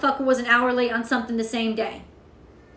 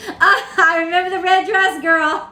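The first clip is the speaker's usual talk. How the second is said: laughing, louder and higher; 4 semitones higher, 5 dB louder than usual. Real, not acted. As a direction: laugh as you greet her. Laughing greeting viewer.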